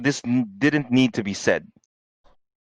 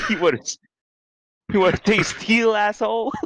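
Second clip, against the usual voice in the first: a hammed-up southern drawl, pitched higher; mock southern drawl; 10 semitones higher than usual. Friend voices a sword-fighting samurai with a mock southern drawl. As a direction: swagger it out like a cocky southern gunslinger. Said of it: "with a southern drawl".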